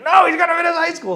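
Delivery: high, firm mock dad's refusal. Dad refusing to let the son leave high school, firm. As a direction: shut it down as the father.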